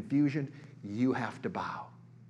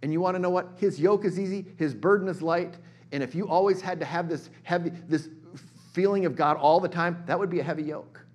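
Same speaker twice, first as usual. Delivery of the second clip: higher, louder preaching; fervent preaching; 6 semitones higher, 6 dB louder than usual. Real, not acted. Preacher telling the church His yoke is easy, fervent. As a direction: lift it and press it home.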